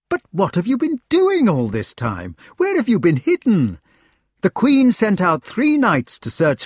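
high, loud, eager outpouring; excited knight. A narrator's reading. Searcher questioning the missing Lancelot, excited. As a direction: pour out questions and news excitedly.

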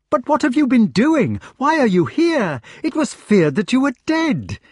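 high-pitched, excited questioning; excited knight. A narrator's reading. Knight questioning friend feared dead, excited. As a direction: pour out questions to a long-lost friend.

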